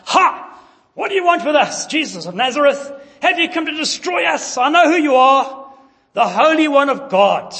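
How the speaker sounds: high, loud demon shout; demon crying out. Retold or imitated. Preacher voicing the demon in the synagogue crying out. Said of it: "the demon cried out".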